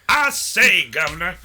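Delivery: hammed-up Louisiana guy calling Governor; Louisiana accent. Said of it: "I was trying to do, like, a Louisiana kind of guy".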